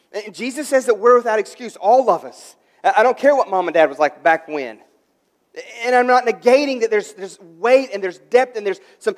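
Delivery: loud, insistent pulpit voice; forceful preaching. Real, not acted. Pastor challenging church's excuses, loud insistent preaching. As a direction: press the point hard, piling up phrase on phrase.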